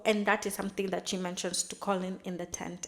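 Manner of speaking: ordinary talk, nothing marked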